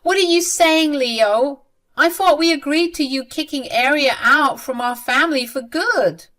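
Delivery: high, loud exasperated protest; acted exasperated mother; super frustrated. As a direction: confront your son in frustration. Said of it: "Lena got super frustrated and said".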